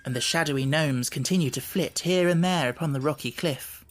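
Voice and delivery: usual speaking voice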